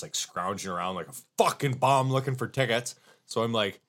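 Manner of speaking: usual speaking voice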